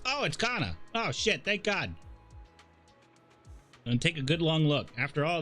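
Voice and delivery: normal talking voice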